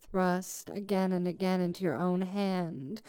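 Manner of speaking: ordinary talk, nothing marked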